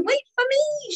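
high, pleading call; desperate shout. Acted. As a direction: keep yelling after the train. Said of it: "shouted Mincemeat".

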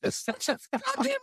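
high hammed-up comeback; mock retort. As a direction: squeak the answer back at him. Retorting to Waddy in retold argument.

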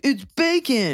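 high, gushing novelty-store pitch; mock hype. Mocking Urban Outfitters' bacon craze, high mock hype. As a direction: squeal it like a silly sales hook.

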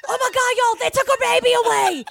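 high, frantic mock announcement; mock frantic news. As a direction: shout the news like a panicked friend. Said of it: "What she says is".

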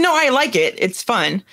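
ordinary talk, nothing marked

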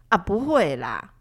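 a slow, mildly impatient brush-off; retold impatience, understated. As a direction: wave her off, a bit fed up. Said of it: "用不耐烦的语气想要防卫".

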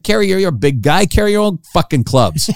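high-pitched exasperated rant, imitated; retold frustration. Caddy tells off Charles Barkley, acted exasperation. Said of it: "this guy's getting really frustrated".

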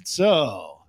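usual speaking voice

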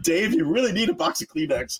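high, laughing scold; mock client telling Dave off; teasing client. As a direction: chide Dave through laughter. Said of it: "they're like".

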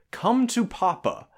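hammed-up European villain accent; lightly voiced European stranger. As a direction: Come to Papa in a mock European accent, slow. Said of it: "in a fairly European accent".

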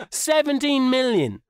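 usual speaking voice

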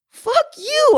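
a playful shocked cry of outrage; mock outraged curse. Retelling her reaction at the bar, mock outraged. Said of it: "And I'm like".

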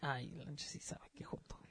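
teasing whispered aside. Real, not acted. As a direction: whisper it behind his back.

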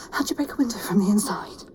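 low, soft muttered question; muttering to oneself. Acted. Trapped woman puzzling to herself in the car, muttered. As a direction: think aloud under your breath.

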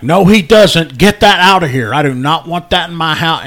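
loud, high, outraged refusal; freaking out. Retold or imitated. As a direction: yell it like you're disgusted and panicking. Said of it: "he just starts to wig out".

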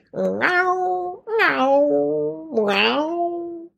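high, stretched cat meows; Zebra Puddle's meow. Retold or imitated. Owner imitates her first cat, high meowing. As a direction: mimic her own cat's meow. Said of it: "a zebra would meow like this".

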